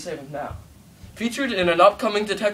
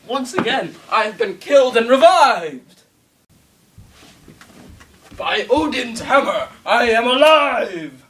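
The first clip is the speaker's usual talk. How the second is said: triumphant shout; 4 semitones higher, 8 dB louder than usual. Acted. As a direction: proclaim being alive. Triumphant revival.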